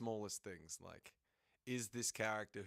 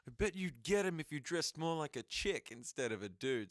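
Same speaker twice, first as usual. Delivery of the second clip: higher, louder playful tease; cheeky teasing voice; 5 semitones higher, 5 dB louder than usual. Shown as a demonstration. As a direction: toss it off as a cheeky dare. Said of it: "restarts the line".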